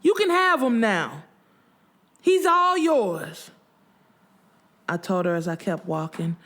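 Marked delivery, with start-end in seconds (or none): 0.0-3.5 high, bitter yell falling away; screaming. A narrator's reading. Widow to rival as she keeps walking, screaming. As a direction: hurl it at her, high and drawn out. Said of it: "screaming all the way to the parking lot".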